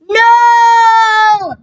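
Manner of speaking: long, high, loud wail of despair; anguished shout. Acted. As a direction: cry out as if the world ended. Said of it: "shouted Miss Cuddy".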